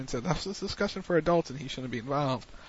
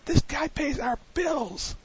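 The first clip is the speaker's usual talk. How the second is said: mock Heyman scream; 13 semitones higher than usual. Heyman screams at Brock to protect his meal ticket, mock shriek. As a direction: shriek it like a panicked manager, slowly. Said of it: "he was screaming".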